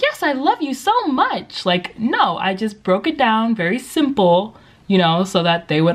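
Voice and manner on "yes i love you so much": high, slow, drawn-out gushing; mock over-affectionate answer to a child; gushing teacher reply. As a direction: gush it high and stretched. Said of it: "instead of being like".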